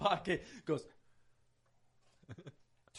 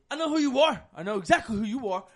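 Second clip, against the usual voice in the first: loud, raised-pitch challenge; retold confrontation; 5 semitones higher, 7 dB louder, faster than usual. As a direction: call him out loudly, acting the belligerent man. Retold man confronts the cop.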